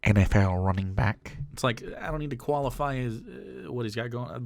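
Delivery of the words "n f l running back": slow, ponderous put-on voice; over-deliberate intellectual delivery, hammed up; affected speaker parody. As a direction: draw each phrase out with mock importance. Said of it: "That's like me saying".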